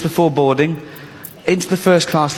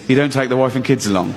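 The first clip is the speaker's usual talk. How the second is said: ordinary talk, nothing marked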